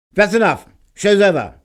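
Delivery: furious, high, loud shout; a husband shouting the show closed, furious. Acted. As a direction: bellow to end the show. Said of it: "shouted Mister Edison".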